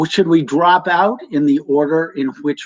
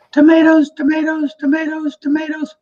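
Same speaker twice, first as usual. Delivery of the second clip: quick, high, staccato tomatoes repeated; rapid-fire tomatoes demo for Louise; 13 semitones higher than usual. Shown as a demonstration. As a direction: rattle off tomatoes fast and high. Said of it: "really like that".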